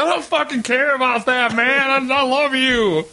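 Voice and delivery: very high, wailing protest; hammed-up tearjerker outburst; overwrought mock declaration. Acting out melodramatic forgiveness of friend.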